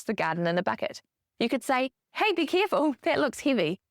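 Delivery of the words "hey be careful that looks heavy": high, falling caution; acted parent's high-pitched heads-up; parent cautioning child. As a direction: gently alert a climbing child, pitched up.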